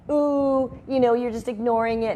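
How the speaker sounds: normal talking voice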